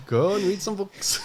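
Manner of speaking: laughing. Real, not acted. Laughing while quoting the clip.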